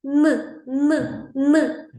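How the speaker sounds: short separate hummed "Н, н, н"; isolated consonant. Shown as a demonstration. Teacher asking if it can sing, short repeated "Н". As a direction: say "Н" alone three times, evenly spaced. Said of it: "Він вміє співати".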